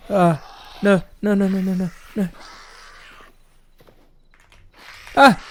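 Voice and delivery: high frantic shrieks of no; panicked screaming. Real, not acted. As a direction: scream no over and over in terror. Chased by monster, screaming.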